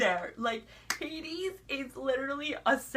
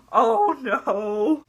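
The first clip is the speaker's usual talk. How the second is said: loud, gasping dread; dramatic dismay; 6 dB louder than usual. Retold or imitated. Viewer learning Belle is pregnant, dismayed. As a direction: blurt it in horrified realisation.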